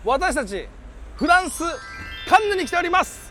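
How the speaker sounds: excited presenter. Acted. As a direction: announce it like a TV host. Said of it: "presenter intro".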